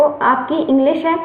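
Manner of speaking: usual speaking voice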